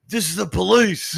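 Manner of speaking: hammed-up police officer at the door; fake police raid. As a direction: announce yourself like raiding cops. Acts out mate faking a police raid.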